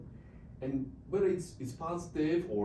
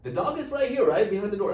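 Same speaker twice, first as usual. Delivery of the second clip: calling out; 8 semitones higher, 11 dB louder, faster than usual. Retold or imitated. Calling through the door.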